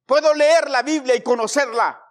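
ordinary talk, nothing marked

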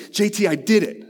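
student telling his pastor he did it, thrilled; excited kid. Retold or imitated. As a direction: burst out with proud news. Luke excitedly reports.

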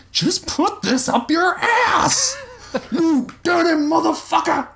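very high, desperate yell; high-pitched frantic shout; strained shouting. A narrator's reading. Shouting struggle in a headlock.

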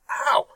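high sudden ow of pain; pained yelp. Real, not acted. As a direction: yelp from hitting the funny bone. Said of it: "I just hit my funny bone".

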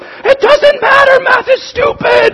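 high, loud, slow crying yell; a furious kid yelling through tears before running off; angry kid shouting. Retold or imitated. Angry junior high kid to counselor, crying shout. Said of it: "he was just angry".

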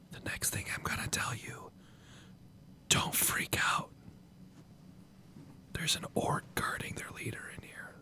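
unvoiced hushed whisper; breathless conspiratorial whisper. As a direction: whisper it so the guards can't hear. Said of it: "Make sure you whisper it".

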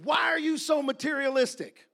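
loud, accusing question; shouted rebuke. Retold or imitated. The Lord rebuking Doctor Schuler, loud rebuke. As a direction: scold forcefully as God would.

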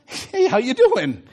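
slow, drawn-out breezy greeting; a hint of easygoing passer-by; lightly voiced casual Jesus. Jesus greeting rowers from the water, casually.

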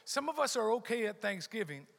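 usual speaking voice

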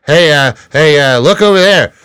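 Norm Macdonald. Acted. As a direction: call out like the stand-up comic. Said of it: "Been working on my Norm".

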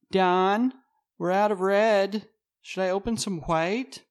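high, loud call from another room; calling out from the next room. Acted. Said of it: "Maggie calls to him from the other room".

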